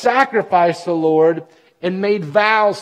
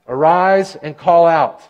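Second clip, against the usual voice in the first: slow, louder Arise and call out with call punched; stress on call; 5 dB louder, slower than usual. Acted. Preacher driving home the command, call stressed. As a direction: hammer the word call slowly.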